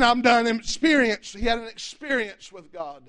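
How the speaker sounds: usual speaking voice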